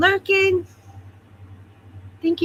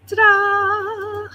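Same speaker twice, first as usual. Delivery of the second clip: playful ta-da; 9 semitones higher than usual. Pretend. Announcing the chat magic.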